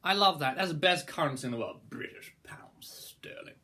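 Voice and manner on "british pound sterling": whispered. Acted. Reverent aside about the pound.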